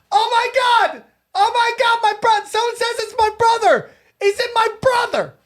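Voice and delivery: very high, panicked wail, acted out; frantic woman. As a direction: imitate a hysterical sister, voice way up high. Woman frantic over dead brother.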